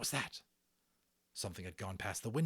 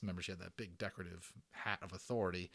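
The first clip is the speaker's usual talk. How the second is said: usual speaking voice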